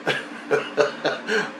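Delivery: short breathy chuckle. Real, not acted. Storyteller amused before his tale, chuckling. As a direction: chuckle to yourself before going on. Own laugh while starting story.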